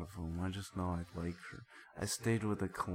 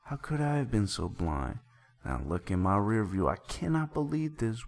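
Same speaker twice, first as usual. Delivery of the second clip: reads the song lyrics as a hammed-up melodrama story; over-earnest storyteller delivery, lightly acted; dramatic lyric reading; 4 semitones higher, 8 dB louder than usual. Podcast host reads the song aloud as a soap opera, hammed-up.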